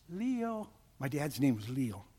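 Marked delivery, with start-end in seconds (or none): 0.1-0.7 higher, soft, drawn-out call; faint distant voice. Retold or imitated. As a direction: imitate a call from far up the shaft. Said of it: "heard a voice way up going".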